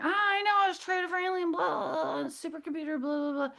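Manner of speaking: high-pitched, rattled-off babble; mock retelling to sister. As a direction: recount it in a high, dismissive blah-blah voice. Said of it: "I'm like, to my sister".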